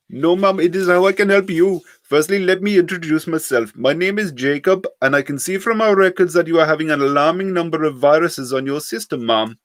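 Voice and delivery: put-on high, loud scam-caller accent; heavy Indian accent. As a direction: play a pushy call-centre scammer. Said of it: "heavily accented voice".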